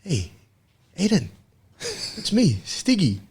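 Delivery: put-on high, delighted call of an old friend; light up, you've just spotted your mate; excited recognition. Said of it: "he was like".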